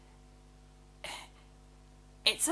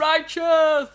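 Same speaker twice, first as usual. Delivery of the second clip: stretched-out surfer drawl, higher; surfer dude voice; 7 semitones higher, 9 dB louder than usual. Retold or imitated. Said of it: "What's his accent here?".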